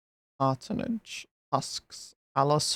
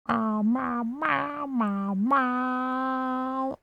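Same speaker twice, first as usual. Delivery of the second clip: high mock meowing; 10 semitones higher, much slower than usual. Saying cat words to game.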